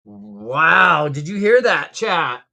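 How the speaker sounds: ordinary delivery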